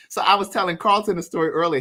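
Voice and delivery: usual speaking voice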